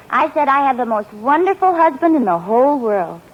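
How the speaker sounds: ordinary talk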